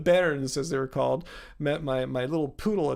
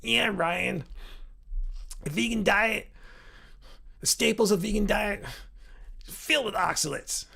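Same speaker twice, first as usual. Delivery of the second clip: higher-pitched, halting put-on accent; Wall Street oxalates guy; 5 semitones higher than usual. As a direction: imitate the oxalates guy's accent, phrase by phrase. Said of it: "do his accent there".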